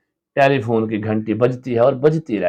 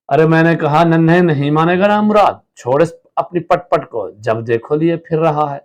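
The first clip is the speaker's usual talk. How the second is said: put-on raised, scolding the noisy child; higher and louder, a mother losing patience; 6 semitones higher, 4 dB louder than usual. Wife scolding her little son over his noisy toy, angrily.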